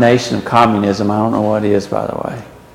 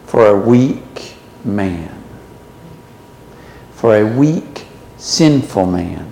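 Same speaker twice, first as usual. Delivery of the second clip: a hushed, grieving God, softer and lower; quiet voice of God; 6 semitones lower, 7 dB quieter than usual. Acted. Preacher voicing God to his congregation, soft and sorrowful. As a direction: speak as a wounded God, gently.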